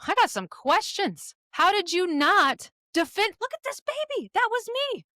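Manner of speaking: put-on an adult child holding up their new baby, demanding answers from the parent; grown child confronting parent. High, pleading confrontation. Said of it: "they look at you and go".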